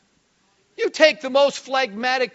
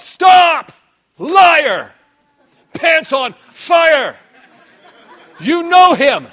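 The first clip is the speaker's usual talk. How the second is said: louder mock-accusing yell; playful scolding shout; 4 semitones higher, 8 dB louder, much slower than usual. Real, not acted. Preacher playfully calling a doubter a liar, mock yell. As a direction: tease the doubter loudly.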